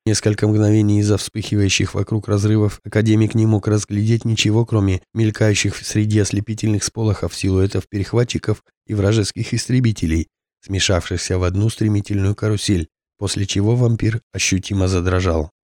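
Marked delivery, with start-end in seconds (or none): none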